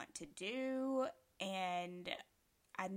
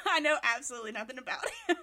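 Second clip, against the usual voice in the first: laughing; 5 semitones higher, 8 dB louder, faster than usual. Real, not acted. Laughing while admitting.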